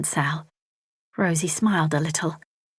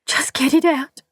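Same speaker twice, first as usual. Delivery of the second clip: higher, louder strained sob; sobbing with effort; 9 semitones higher, 4 dB louder than usual. A narrator's reading. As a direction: beg through the pain. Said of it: "sobbing with the effort".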